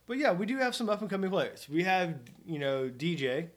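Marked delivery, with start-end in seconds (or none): none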